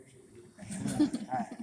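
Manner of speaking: high breathy laugh; high wheezy burst of laughter; amused laugh. Real, not acted. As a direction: crack up at your own story. Laughs at his hiding.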